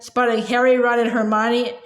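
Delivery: ordinary delivery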